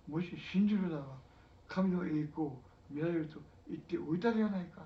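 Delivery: Jesus's words proclaimed at full voice; loud rebuke. Acted. Jesus reminding Martha to believe, proclaimed loudly. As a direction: ring it out as a firm rebuke.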